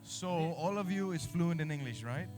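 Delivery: Danish-accented Indonesian; foreigner speaking Indonesian. Real, not acted.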